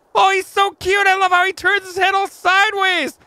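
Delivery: cooing. Real, not acted. Cooing over cute pet.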